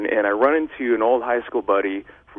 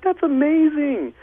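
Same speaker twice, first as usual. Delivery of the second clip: much higher, slow and awestruck; amazed kid; 13 semitones higher, slower than usual. Retold or imitated. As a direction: savor it like a child in wonder. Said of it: "and you're like".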